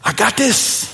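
a touch of cocky swagger; mocking the culture's motto, lightly cocky; mock self-reliance. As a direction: toss it off like a know-it-all. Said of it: "we don't need each other".

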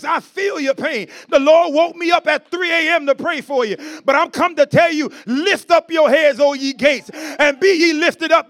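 rousing, rhythmic pulpit proclamation; impassioned preaching. Real, not acted. Preacher lifting up a weary brother with scripture, impassioned. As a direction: build in force and declaim each phrase to the crowd. Preaches at rising full force.

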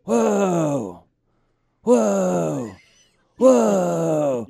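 loud, drawn-out falling whoas; Keanu Reeves. Acted. Actor watches a magic show, loud drawn-out awe. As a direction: marvel on one long syllable, impression style. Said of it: "This is Keanu Reeves at a magic show".

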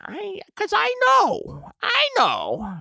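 put-on very high, slow old lady; knowing old neighbor. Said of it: "she's just like".

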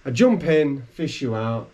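ordinary delivery

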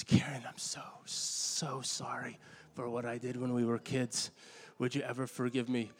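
retold soft, whispered plea for forgiveness; whispered apology. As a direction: breathe the apology out like a shy confession. Sue's remorseful apology to Karen, retold.

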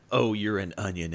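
ordinary talk, nothing marked

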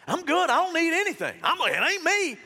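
high, loud, brushing-off insistence; mock defensive denial. Preacher voices a stubborn relative, loudly defensive. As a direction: wave off your family, defensive.

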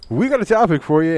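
loud, high mock announcement; hammed-up hype. Host exaggerates his own line.

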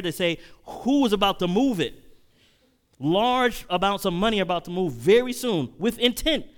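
loud, high-pitched sermon warning; preacher climbing to an urgent peak; preaching at full force. Acted. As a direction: build the alarm for the congregation.

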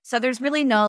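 normal talking voice

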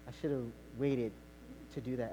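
soft, low apologetic voice; sheepish apology. Retold or imitated. Wife quietly apologizing to her husband. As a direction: say it meekly, owning the mistake.

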